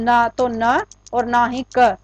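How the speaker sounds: ordinary talk, nothing marked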